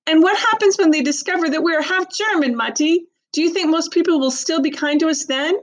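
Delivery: put-on high, bitter challenging girl; upset girl voice. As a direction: challenge mother with hurt. Sister confronts mother bitterly.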